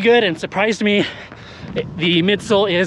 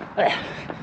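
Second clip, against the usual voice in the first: harsh unvoiced cough; coughing fit; 8 dB quieter than usual. Real, not acted. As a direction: cough up a swallowed bug. Just swallowed a bug.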